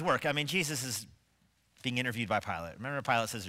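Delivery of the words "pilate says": usual speaking voice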